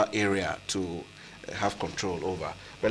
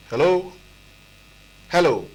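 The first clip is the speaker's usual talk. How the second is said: calling out; 6 semitones higher, 3 dB louder than usual. Real, not acted. Calling out to a silent caller.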